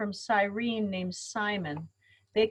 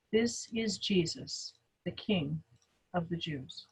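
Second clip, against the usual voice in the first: hushed reading; 3 semitones lower, 9 dB quieter than usual. Acted. Inscription read over the cross.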